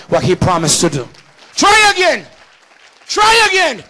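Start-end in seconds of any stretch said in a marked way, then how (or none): 1.5-3.8 high, loud urging; shouted exhortation. Acted. Preacher urging the church, loud and high. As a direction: drive it home, full voice.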